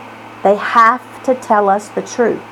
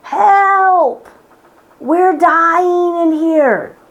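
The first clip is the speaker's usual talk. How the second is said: a trapped body begging to be let out; mock scream, drawn out and high; body screaming for help; 5 semitones higher, much slower than usual. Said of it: "it's screaming".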